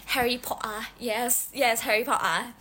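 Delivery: British accent with dropped t in Potter. Shown as a demonstration. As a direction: put on a British 'Po'ah'. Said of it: "Kenapa nih tiba-tiba British accent?".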